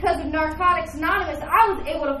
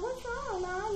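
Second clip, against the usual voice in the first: very soft, higher-pitched worried question; hushed concern; 5 semitones higher, 13 dB quieter, slower than usual. Retold or imitated. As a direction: ask gently, barely aloud. Acted worried question to crying mother.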